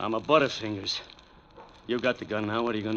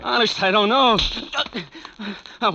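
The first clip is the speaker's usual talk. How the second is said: panicked plea; 12 semitones higher, 8 dB louder than usual. Acted. Thug pleads under threat.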